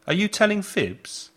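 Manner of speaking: put-on high, teasing voice to a child; playful scolding parent. Shown as a demonstration. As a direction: tease a child who fibs. Phrase used with children, demo.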